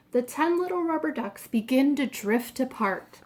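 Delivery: usual speaking voice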